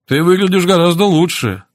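high, cheery encouragement; cheerful. A narrator's reading. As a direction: cheer the patient up with a lifted voice. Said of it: "весело поздоровался он".

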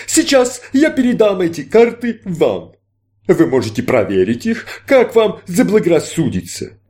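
grand stage magician, inviting; showman magician. Acted. Magician presents cards.

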